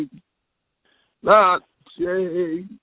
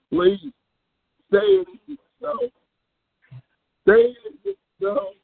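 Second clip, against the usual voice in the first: hammed-up weepy plea, pitched much higher; mock crying; 10 semitones higher, faster than usual. Prank caller wailing to the chat line in mock tears. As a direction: fake sobbing plea, high and pleading. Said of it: "I can't fight back the tears".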